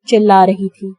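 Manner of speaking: ordinary delivery, nothing marked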